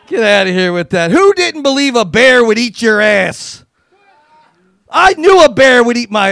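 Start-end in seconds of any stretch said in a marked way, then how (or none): none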